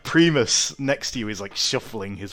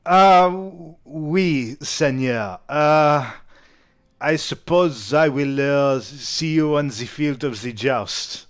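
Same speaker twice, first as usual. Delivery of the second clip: put-on slow, hesitant French accent; French-accented knight; 2 semitones higher, 4 dB louder, slower than usual. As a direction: take leave haltingly in a French accent. GM voices the Bretonnian knight.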